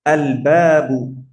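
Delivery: drawn-out example word; slow model word. Shown as a demonstration. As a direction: stretch আল Babu out for learners. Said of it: "slow model word".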